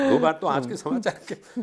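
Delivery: real laughter spilling over the retold line; laughing through words. Real, not acted. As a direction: talk while still laughing. Laughing while retelling.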